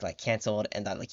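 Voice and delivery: usual speaking voice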